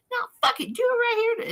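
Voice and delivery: very high, loud staged dare; mock defiant challenge. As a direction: escalate the fake fight, yelling high. Escalating the acted bar fight.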